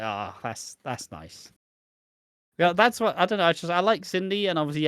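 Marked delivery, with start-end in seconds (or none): 0.0-1.5 lower, softer contented murmur; soothed sigh. Retold or imitated. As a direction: melt a little at the hug. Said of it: "I'm like".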